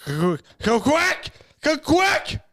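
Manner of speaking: a hammed-up hunter calling the birds in; mock duck call. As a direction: holler at the ducks to quack. Calling ducks on a hunt.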